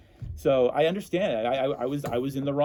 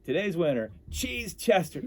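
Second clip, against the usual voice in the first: high ringing announcer call of the winner; 6 semitones higher, slower than usual. Retold or imitated. Pierogi race announcer naming the winner, high announcer call. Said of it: "I hear the Pro Race announcer say".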